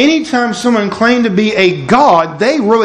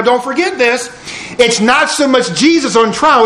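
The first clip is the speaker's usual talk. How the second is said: ordinary talk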